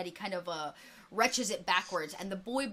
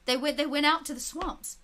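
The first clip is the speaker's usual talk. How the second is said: put-on eager boy; 6 semitones higher, 4 dB louder than usual. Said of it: "the boy, like, nods and says".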